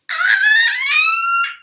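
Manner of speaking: very high loud shriek; a sudden shrieking cry of a scratched cat; piercing cat scream; cat's shriek of pain. Acted. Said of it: "said Meow Meow".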